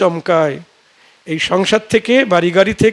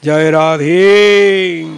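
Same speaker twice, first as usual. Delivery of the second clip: devotional call; 3 semitones lower, 4 dB louder, much slower than usual. Real, not acted.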